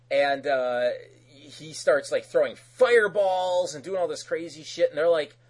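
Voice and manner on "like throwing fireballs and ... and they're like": usual speaking voice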